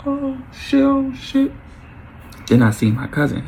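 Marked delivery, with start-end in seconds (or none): 0.1-1.5 very high-pitched panicked exclamation; mock panic. Man retelling how he dived from bullets, high mock panic. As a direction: squeal it like ducking gunfire. Retold reaction to being shot at.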